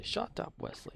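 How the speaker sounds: whispered shut up, Wesley; whispered take. Shown as a demonstration. Streamer whispers the meme line for chat. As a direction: breathe it out as a whisper. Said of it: "Imagine ten minutes of shut up".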